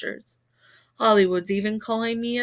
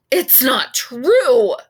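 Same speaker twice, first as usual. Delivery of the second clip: loud, slow furious scream; 2 semitones higher, 11 dB louder, much slower than usual. A narrator's reading. Kaya yelling at the boys at school, loud slow scream. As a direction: scream it right in their faces. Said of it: "Kaya Kaneki screamed in our faces".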